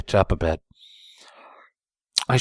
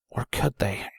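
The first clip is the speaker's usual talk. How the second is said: whispered; 3 semitones higher, 8 dB quieter than usual. A narrator's reading. Hopeful thought.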